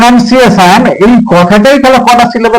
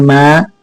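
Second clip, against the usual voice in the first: "Mathematics" lower, each syllable on its own; 4 semitones lower, much faster than usual. Shown as a demonstration. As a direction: leave long gaps between ম্যা, থা, মে, tricks in "mathematics". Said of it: "দেখো চারটে syllable". ("Mathematics" প্রতিটি syllable আলাদা করে)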